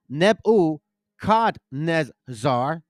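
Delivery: Nebuchadnezzar as neb-oo-KHAD-nez-ZAR; slow, separated neb-oo-khad-nez-zar for Nebuchadnezzar; corrected pronunciation. Shown as a demonstration. Preacher teaches how you say it, Nebuchadnezzar slowly syllable by syllable. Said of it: "that's how you say it".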